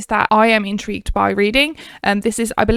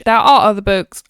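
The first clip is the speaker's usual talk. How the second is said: usual speaking voice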